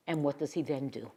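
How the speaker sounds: normal talking voice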